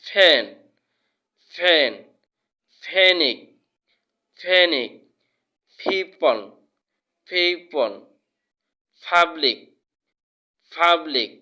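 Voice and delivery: "ফ্যান", "পিপল", "পাবলিক" opening with ph; aspirated P. Shown as a demonstration. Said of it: "শুদ্ধ উচ্চারণ হচ্ছে".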